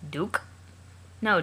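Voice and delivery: ordinary delivery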